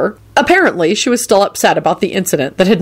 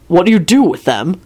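plain reading voice

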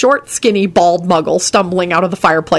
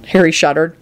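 plain reading voice

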